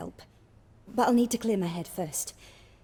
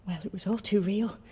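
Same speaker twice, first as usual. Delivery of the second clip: ordinary talk, nothing marked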